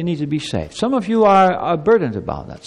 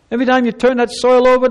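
loud, higher preaching voice, driving the point; forceful preaching; 7 semitones higher, 5 dB louder than usual. Acted. Pastor urging children about sin, forceful preaching. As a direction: preach it hard to the congregation.

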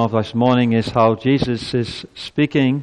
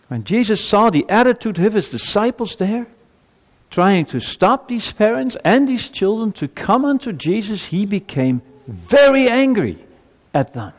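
preaching at full force; 9 semitones higher than usual. Real, not acted. Preacher building to Jesus' anger.

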